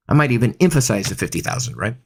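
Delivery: ordinary delivery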